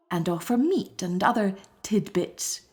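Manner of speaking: usual speaking voice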